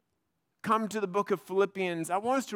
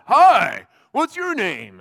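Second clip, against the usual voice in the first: a hearty, much louder hello; loud tipsy greeting; 13 dB louder, much slower than usual. Retold or imitated. Tipsy seatmate greeting the pastor next to him, loud and hearty. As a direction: boom it at the stranger beside you.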